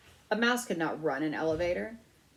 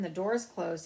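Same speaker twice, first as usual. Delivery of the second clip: ordinary delivery, nothing marked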